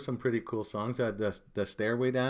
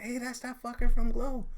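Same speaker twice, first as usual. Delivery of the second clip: excited; 13 semitones higher, 5 dB louder than usual. Retold or imitated. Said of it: "I'm like".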